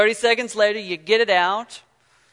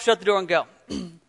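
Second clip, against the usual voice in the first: normal talking voice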